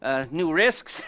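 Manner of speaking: usual speaking voice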